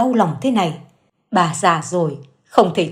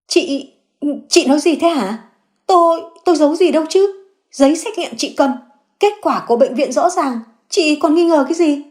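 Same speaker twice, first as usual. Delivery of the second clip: cornered woman blurting out a panicked denial; flustered denial; 8 semitones higher than usual. Acted. Xuyến denies the secret to Nương, high and flustered.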